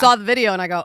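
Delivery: normal talking voice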